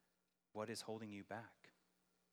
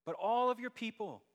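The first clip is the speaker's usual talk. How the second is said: much higher, louder pleading cry; impassioned cry to God; 12 semitones higher, 8 dB louder than usual. Retold or imitated. Preacher voicing David's plea to God, a loud high cry. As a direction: cry it out to God for all his people. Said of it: "He says".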